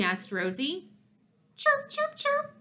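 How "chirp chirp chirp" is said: very high bird-like chirps; chirping. A narrator's reading. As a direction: chirp like a tiny agitated bird. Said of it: "perched on Rosie's shoulder and chirped".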